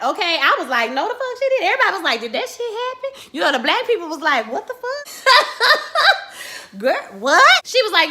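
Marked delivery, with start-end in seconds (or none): 0.9-1.6 high stunned squeal of disbelief; shocked squeal. Retold or imitated. Shocked reaction retold. 2.2-3.3 high, hushed disbelief; the whole dinner table stunned; stunned whisper-squeal. Retold or imitated. Everybody's shocked reaction. 4.5-7.4 high, slow, laughing disbelief; laughing shock. Retold or imitated.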